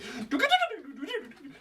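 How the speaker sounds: high, rapid mouth-made string music; imitated montage strings. Shown as a demonstration. Player scoring a flashback montage, imitated strings. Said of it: "a flashback montage with music and strings".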